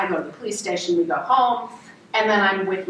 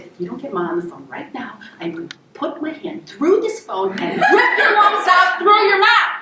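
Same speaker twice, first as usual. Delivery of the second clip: building angry threat; 6 semitones higher than usual. Retold or imitated. Older sibling threatening little brother on the phone, anger building.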